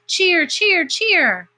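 very high, loud bird calls; calling sparrows. A narrator's reading. Sparrows call to the girl under the tree, high chirping. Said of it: "the sparrows called to Pear Blossom".

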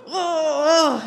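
high, loud laughing whoa; mock alarm, caught out; laughing startled whoa. Retelling alarm at being called out.

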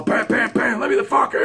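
high, strained mock yell; gunman yelling. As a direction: imitate pounding and a yell from behind a door. Gunman still pounding and yelling.

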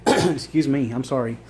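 ordinary talk

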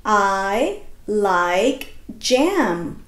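slow, word by word; slow reading aloud. Shown as a demonstration. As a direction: read it slowly for the class. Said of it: "let's read it".

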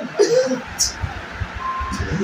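breathless squeal of laughter; helpless high-pitched cackle; high wheezing laugh. Real, not acted. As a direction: lose it completely at the video. Cracking up at the clip.

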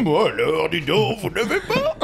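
high, slow wizard voice with a laugh; Dumbledore voice. Shown as a demonstration. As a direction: play the old wizard, slightly amused. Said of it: "la voix de Dumbledore".

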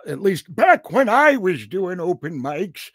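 drawn-out old-timer reminiscing, hammed up; mock old-timer. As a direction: stretch it out like a creaky tall tale. Old-timer bit about open mics.

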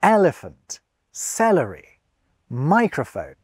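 EL-ephant, CEL-ery, MI-crophone, each set apart; "Elephant, celery, microphone", each falling away after the opening stress; first-syllable stress. Shown as a demonstration. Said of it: "The stress always on the first syllable".

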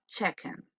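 "chicken" with a short e sound, /chéken/; correct chicken. Shown as a demonstration. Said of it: "no, chicken".

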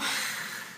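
breathy burst of laughter; real laughing. Real, not acted. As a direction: laugh at a private memory. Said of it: "I'm just laughing".

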